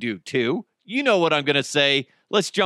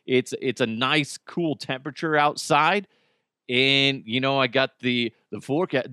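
ordinary talk

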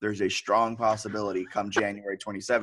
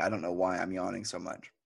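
ordinary talk, nothing marked

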